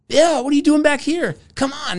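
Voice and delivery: high, brisk woman's voice waving him forward; lady calling him over. Retold or imitated. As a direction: pitch way up, a friendly hurry-up call. Lady pulls him from line.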